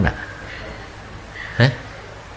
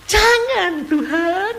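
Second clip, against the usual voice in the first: mock plea; much slower than usual. Pleading with God over sick child.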